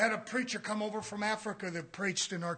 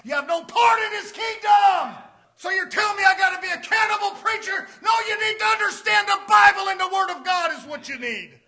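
loud, high sermon shout; fired-up pulpit delivery; full-force preaching; 9 semitones higher, 13 dB louder than usual. Real, not acted. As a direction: preach it out at the top of your voice.

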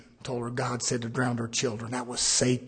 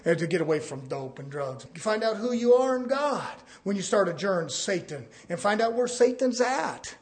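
ordinary talk, nothing marked

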